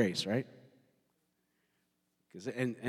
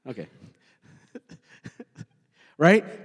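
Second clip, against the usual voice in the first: ordinary talk, nothing marked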